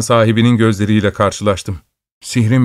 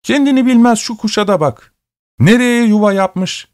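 shopkeeper voice; 11 semitones higher than usual. Acted. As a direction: tease it like an amused shopkeeper. Shopkeeper mocks bird in hat.

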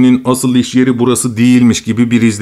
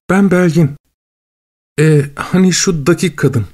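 upbeat, lively woman's voice; cheerful voice; 5 semitones higher than usual. A narrator's reading. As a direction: sound bubbly and pleased. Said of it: "Pek neşeli bir ses".

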